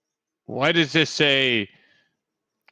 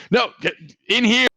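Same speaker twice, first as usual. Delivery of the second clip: urgent; 10 semitones higher, 3 dB louder than usual. Acted. Worker urging kid.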